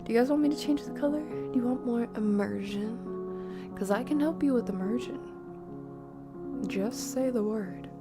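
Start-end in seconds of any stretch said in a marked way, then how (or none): none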